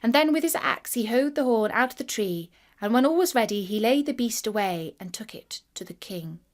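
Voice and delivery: plain reading voice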